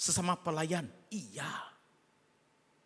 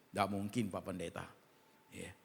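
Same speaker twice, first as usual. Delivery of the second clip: ordinary delivery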